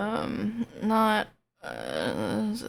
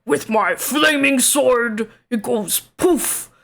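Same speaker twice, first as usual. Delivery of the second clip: Gruff; 6 semitones higher, 6 dB louder than usual. Shown as a demonstration. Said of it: "that's Gruff's voice".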